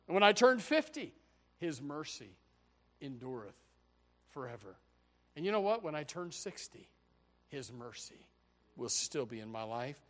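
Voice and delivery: ordinary talk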